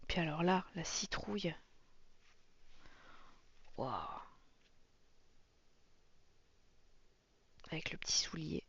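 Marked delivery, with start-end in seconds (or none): 3.8-8.6 whispered in awe, barely voiced; soft, breathy whisper of wonder; hushed whisper. Real, not acted. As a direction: hush it like you're afraid to break the spell. Hushed admiring of a page.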